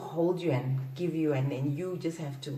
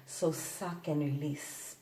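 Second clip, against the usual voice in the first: ordinary talk, nothing marked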